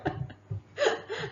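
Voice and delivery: high breathless giggles, can't stop; helpless giggling fit. Real, not acted. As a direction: lose it completely, laughing. Helpless laughter after failed accent.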